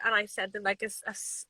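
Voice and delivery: normal talking voice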